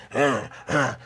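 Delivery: throaty grunts building to growls; imitated wild-man grunts; demonic grunting. Imitates the possessed man's noises.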